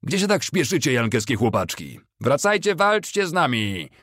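high-pitched taunting call; jeering. A narrator's reading. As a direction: call mockingly after a retreating enemy, building in volume. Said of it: "Kawalerzyści szydzili z wycofującego się".

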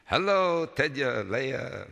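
high, singing polite greeting; neighbourly hello, starting high and loud; polite neighbour lady. A narrator's reading. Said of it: "she said politely".

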